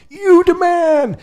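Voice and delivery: high, drawn-out mock holler; imitating a joker's loud whoop; hammed-up punchline. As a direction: belt out the punchline, stretched and high. Mimicking the man's own punchline.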